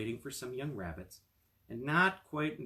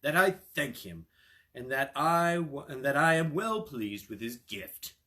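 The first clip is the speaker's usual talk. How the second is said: measured and grand, a monarch accepting tribute; the king speaking; 4 semitones higher, 8 dB louder than usual. Acted. King answers the cat.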